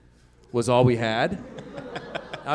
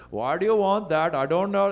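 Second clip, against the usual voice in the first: higher, badly faked Indian accent; 5 semitones higher than usual. Hack comic voicing gas station attendant, bad Indian accent. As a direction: do a clumsy mock Indian accent. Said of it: "trying to do an Indian accent".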